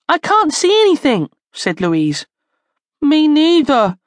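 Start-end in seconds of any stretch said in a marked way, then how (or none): 0.1-1.3 put-on high, anxious child's voice; alarmed. A narrator's reading. Louise to her friends in the pitch dark, alarmed. Scared children in total darkness. 3.0-4.0 put-on high, scared child's whine; whimpering. A narrator's reading. Charlie blinded in the gloom beside friends, whimpering. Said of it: "whimpered Charlie".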